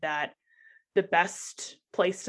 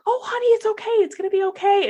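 comforting nurse; 13 semitones higher, 6 dB louder, faster than usual. Retold or imitated. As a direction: soothe a crying patient like a kind nurse. Nurse comforting her while crying.

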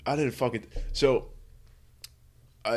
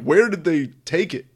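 mock exasperated question; 6 semitones higher, 6 dB louder than usual. Acted wished-for retort to librarian.

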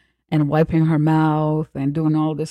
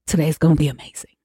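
hyped-up fast; much faster than usual. Shown as a demonstration. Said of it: "Let's start over".

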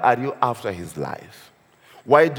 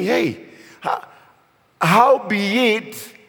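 mocking; 5 semitones higher than usual. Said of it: "[name] is kind of mocking this guy".

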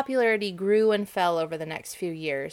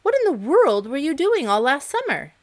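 scolding ants; 7 semitones higher, 6 dB louder than usual. Acted.

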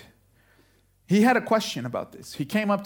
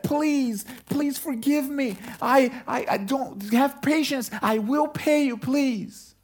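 higher, pleading voice; kneeling servant pleading for more time; desperate begging; 7 semitones higher, slower than usual. Acted. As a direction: beg for mercy on your knees. Fellow servant on knees begging.

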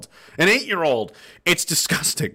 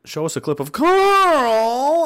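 put-on voice; 10 semitones higher, 3 dB louder, much slower than usual. Reading a viewer request.

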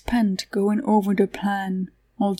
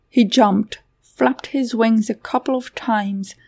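usual speaking voice

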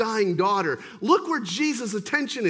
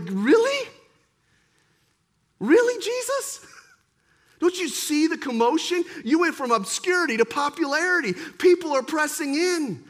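Peter pitched higher, in disbelief; exasperated Peter questioning Jesus; incredulous Peter; 4 semitones higher than usual. Acted. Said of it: "Peter's kinda correcting Jesus here".

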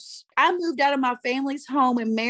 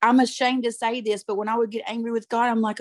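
ordinary talk, nothing marked

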